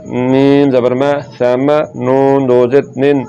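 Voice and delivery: ordinary delivery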